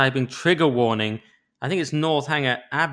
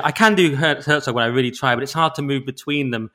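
ordinary talk

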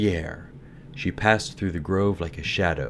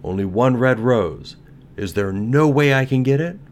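louder, higher pleading cry; cried; 6 semitones higher, 7 dB louder than usual. A narrator's reading. Nightingale begs the tree for a way, crying out. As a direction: plead with raised voice. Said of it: "cried the nightingale".

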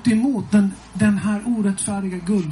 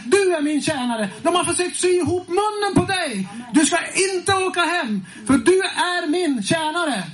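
much higher, forceful prophetic proclamation; prophetic roar; 9 semitones higher than usual. Retold or imitated. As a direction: cry it out over him like a prophet. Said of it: "bara vrålar". (profetiskt vrål med mycket hög röst)

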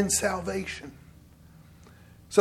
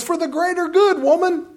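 hammed-up Frozone; 10 semitones higher, 4 dB louder than usual. Retelling Incredibles argument, husband voice.

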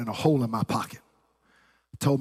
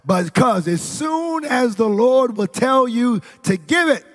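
raised-pitch sermon delivery, building in force; impassioned preaching; 4 semitones higher, 4 dB louder than usual. Real, not acted. Preacher urging congregation to obey and give, impassioned preaching. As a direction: press it on the congregation, climbing toward the end.

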